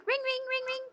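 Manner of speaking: high, bright imitation of a ringing phone. Streamer mimics the ringing phone she just found. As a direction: sing out the rings like a toy phone.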